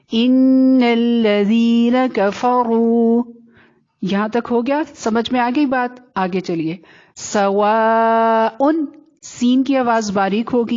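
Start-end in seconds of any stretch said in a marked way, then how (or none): 0.1-3.2 very slow, held long vowels; stretched recitation. Shown as a demonstration. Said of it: "کھینچ کر پڑھنا ہے". 7.1-8.5 سواء with a long-held aa; long madd. Shown as a demonstration. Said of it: "اس کو خوب کھینچیں گے".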